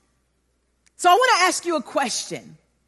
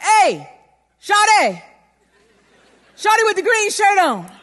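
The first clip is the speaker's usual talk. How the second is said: higher, louder holler across the mall; calling out; 6 semitones higher, 5 dB louder than usual. Retold or imitated. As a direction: call out to a girl across the mall. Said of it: "He's like".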